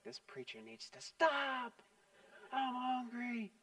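soft, then a whisper, slow mock complaint; whispered grumble about the long sermon; grumbling aside. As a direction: mutter it like a bored, hungry listener in the pew. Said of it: "He's like".

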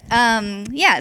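normal talking voice

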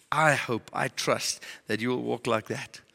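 normal talking voice

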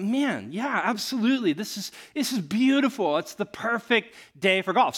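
lifted, delighted golfer chat; enthusiastic agreement. Retold or imitated. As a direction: gush in happy agreement. Acts out his eager agreement.

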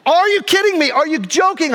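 high-pitched indignant outburst; retold outrage. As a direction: replay being mad at a driver. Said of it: "I was so mad".

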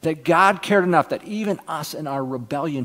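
ordinary talk, nothing marked

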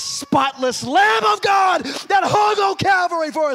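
high, drawn-out holler; slow, high preacher's cry; climactic preaching shout. Real, not acted. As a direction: stretch the words in a fervent shout. Climax of the sermon.

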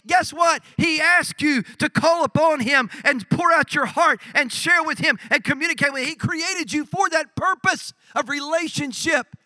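high, loud, driving sermon delivery; full-force preaching. Real, not acted. Preacher telling why Nehemiah turned to God, full-force preaching. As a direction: drive it home high and loud.

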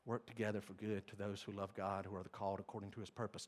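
soft, low, reverent reading; quiet scripture recital. Acted. Preacher reciting Romans to the church, soft and low. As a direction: read it softly as a promise. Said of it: "Romans eight twenty-eight".

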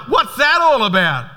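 high, drawn-out bewildered question; mock freak-out. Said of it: "my boyfriend freaked out".

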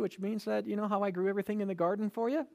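ordinary delivery

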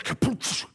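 mouthed "kapoosh" splash noise; imitated splash. Preacher imitating man and millstone hitting sea, imitated splash. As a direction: burst it out like something heavy hitting water.

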